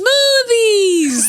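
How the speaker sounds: high, drawn-out comic yell; imitated character's cry. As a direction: belt it out high, mimicking the movie. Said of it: "That's what she says".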